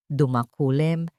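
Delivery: usual speaking voice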